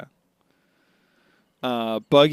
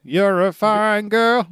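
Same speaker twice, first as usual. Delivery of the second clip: much higher, louder, slow swooning voice; flirty admirer voice; 10 semitones higher, 6 dB louder, slower than usual.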